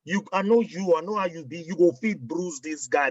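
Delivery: normal talking voice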